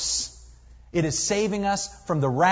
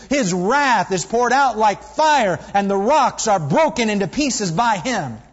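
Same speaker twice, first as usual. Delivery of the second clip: scripture reading; 2 semitones higher, 4 dB louder than usual. Acted. Reads Nahum passage aloud.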